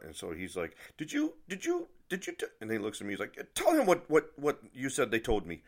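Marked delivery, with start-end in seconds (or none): 1.0-2.5 high-pitched, stammering demand; imitated worked-up clerk; spluttering confrontation. 3.5-5.6 high, loud, stammered order; imitated irate clerk; agitated demand.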